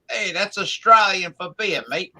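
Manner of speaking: a quoted beer-ad line in mock Australian accent; quoting the old Foster's ad, raising pitch in mock Australian. Friend quotes the Foster's beer commercial, mock Australian accent. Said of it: "Remember the Foster's commercial?".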